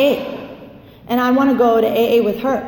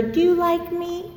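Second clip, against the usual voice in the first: needy people pleaser; 6 semitones higher, 4 dB quieter, slower than usual. Retold or imitated. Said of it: "people pleaser".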